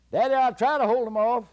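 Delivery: very high, loud call; high shouted promise. Acted. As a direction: shout it so the giant hears. Jack calls to the hidden giant.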